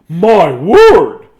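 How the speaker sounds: booming, high-pitched astonishment; big man. A narrator's reading. Giant man spotting a penguin, booming and amazed. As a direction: exclaim it at full volume.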